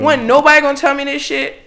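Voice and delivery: high, loud retold complaint; indignant outburst. Venting to co-hosts about a late deadline, indignant.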